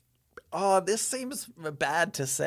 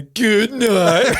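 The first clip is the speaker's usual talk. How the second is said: higher, louder, laughing copy of "Good night"; mocking echo; 8 semitones higher, 9 dB louder than usual. Son mocking dad's goodnight, laughing, higher and louder. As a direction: mimic dad with a laugh. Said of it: "I'm not putting the emphasis on it".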